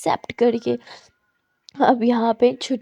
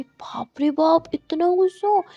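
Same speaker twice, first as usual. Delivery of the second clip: awed whisper; 5 semitones higher than usual. Acted. Awed at friend's anger.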